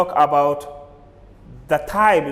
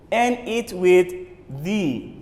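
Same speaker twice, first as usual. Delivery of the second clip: ordinary talk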